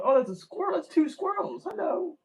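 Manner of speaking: real high-pitched distracted surprise; delighted surprise. Real, not acted. Speaker suddenly notices squirrels mid-talk, in high, real surprise.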